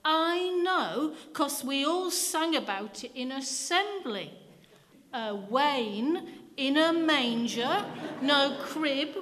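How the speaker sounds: put-on a small boy triumphantly quoting the carol. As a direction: crow it out like proof. Boy triumphantly quotes hymn.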